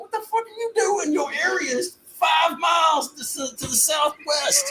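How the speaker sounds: yelling at worker. Retold or imitated. Player yelling at a landscaper in the wrong corner, high exasperated shout. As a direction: bellow at a lazy worker, shocked and high. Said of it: "I'm like".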